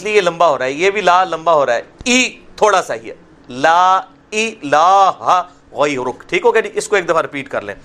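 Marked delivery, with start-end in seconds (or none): 3.6-6.2 لا الہ غیرک with لا and الہ held long; لا کو کھینچ کر پڑھیں; very slow. Shown as a demonstration. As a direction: stretch the لا in لا الہ غیرک very slowly. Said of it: "یہ کھڑی حرکت ہے".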